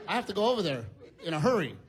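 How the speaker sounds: ordinary delivery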